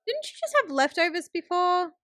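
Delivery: high-pitched baffled questions; puzzled disbelief. Real, not acted. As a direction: question it in mild exasperation. Puzzled by character cooking again.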